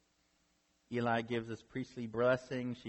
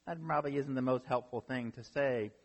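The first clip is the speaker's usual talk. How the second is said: ordinary delivery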